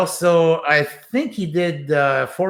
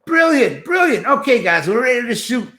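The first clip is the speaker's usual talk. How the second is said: high, bright, thrilled praise; excited praise; 6 semitones higher, 3 dB louder than usual. Retold or imitated. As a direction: cheer it like a delighted director. Director praises, calls the crew.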